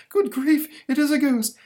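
very high, loud cartoon monster voice; startled monster. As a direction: squeal it out like a spooked cartoon monster. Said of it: "the monster recoils with".

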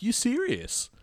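high, incredulous question; mock disbelief; disbelieving. Narrator hearing the old fan is going home, incredulous.

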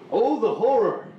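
loud, high melodramatic wail; hammed-up horror lament; dramatic horror. Repeated comic catchphrase.

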